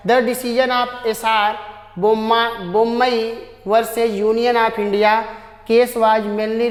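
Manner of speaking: slow, careful reading of the question; slow dictation reading. Shown as a demonstration. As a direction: read each word slowly so students can follow. Teacher reads question aloud slowly. (धीमे-धीमे प्रश्न पढ़ना)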